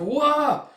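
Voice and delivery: very high, loud crying wail; child's wail. Acted. Small brother seeing his sister's head, wailing. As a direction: let out a child's anguished cry.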